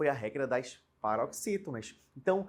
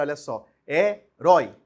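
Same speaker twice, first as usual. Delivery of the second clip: herói said loud and slow, stress on the final rói; emphatic word demo; 9 dB louder than usual. Shown as a demonstration. Teacher comparing herói with heróico, stressing it loudly. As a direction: slowly punch the ending of herói. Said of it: "se a gente for comparar".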